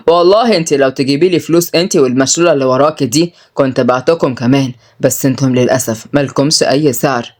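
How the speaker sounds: low-voiced contemptuous man; scornful villain, mocking. Villain tells sisters they are worthless, scornful. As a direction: belittle them in a low voice.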